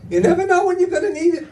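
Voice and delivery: high, mock motherly nagging voice; mother imitation. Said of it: "My mother's like".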